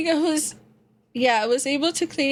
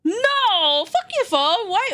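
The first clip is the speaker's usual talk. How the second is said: high, loud outburst at the phone assistant; yelling at the phone assistant that butted in; exasperated yell; 9 semitones higher, 3 dB louder, slower than usual. Real, not acted. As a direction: snap at the phone, fed up. Phone assistant wrongly triggered.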